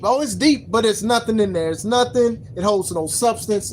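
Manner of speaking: ordinary delivery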